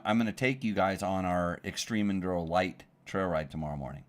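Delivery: ordinary talk, nothing marked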